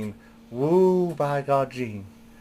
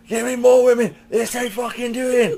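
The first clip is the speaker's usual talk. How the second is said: much higher, louder put-on Flair voice; imitating Ric Flair; 11 semitones higher, 5 dB louder than usual. Ric Flair demanding more women at the hotel. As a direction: ham up the old wrestler demanding. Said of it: "he posed downstairs going".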